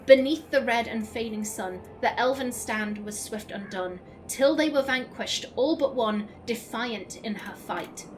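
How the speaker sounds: measured, solemn poem recital; steady verse reading. Acted. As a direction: read it like a ballad. Reads poem aloud in game.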